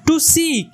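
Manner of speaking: weak to, strong seek; stress on seek. Shown as a demonstration. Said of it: "সিকটাকে আমরা জোরে বলছি". ("seek" শব্দে জোর, "to" হালকা)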